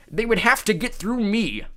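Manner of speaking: loud high growling giant; cyclops growl. A narrator's reading. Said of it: "Polyphemus growled".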